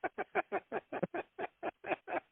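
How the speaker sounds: light high giggle under the breath; soft high laugh. Real, not acted. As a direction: giggle softly at the memory. Laughing at story.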